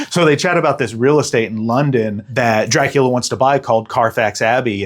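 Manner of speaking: ordinary delivery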